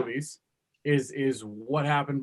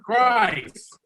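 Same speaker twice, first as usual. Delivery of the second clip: exasperated; 7 semitones higher than usual. Real, not acted. Judge reacts to accents.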